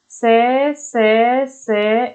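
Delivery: each से held and set apart; letter-by-letter recital. Shown as a demonstration. Teacher listing ऊष्म letters for students, drawn out singly. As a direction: dictate the sounds one at a time.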